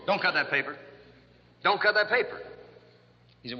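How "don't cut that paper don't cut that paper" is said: raised, high-pitched repeated order; mock shouted command. Therapist repeats his order to the woman cutting paper, mock shouting. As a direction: deliver it as an insistent comic cure.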